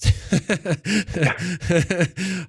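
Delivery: a big, real burst of laughter; hearty self-mocking laugh; loud laugh. Real, not acted. Laughing at own folly.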